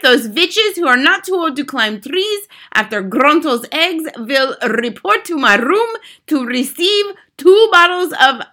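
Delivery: high, loud commanding witch with rolled r's; imperious head witch. A narrator's reading. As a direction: issue orders to your coven, loud and high.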